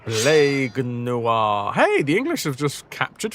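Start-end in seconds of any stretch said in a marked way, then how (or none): 0.0-1.7 very slow, drawn-out name; dramatic slow name. Pretend. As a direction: roll the name out ominously. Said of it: "I don't know why I said it like that".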